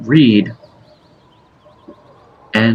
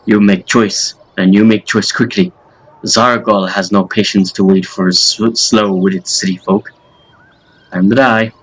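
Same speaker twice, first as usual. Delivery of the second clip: put-on thick-tongued, menacing Russian accent; Zargol, Russian accent. Villain demanding city folk surrender or die, Russian accent. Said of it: "I don't know why I made him Russian".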